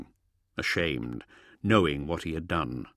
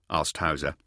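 usual speaking voice

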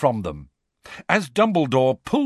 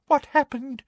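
whimper; 14 semitones higher than usual. Acted. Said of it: "he added in a kind of whimper".

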